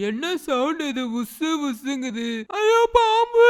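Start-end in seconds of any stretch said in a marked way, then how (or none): 2.6-3.5 high, loud panicked cry of alarm; scared shout. Acted. Child spots a snake in the tent, panicked loud shout. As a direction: yell it like you just saw a snake.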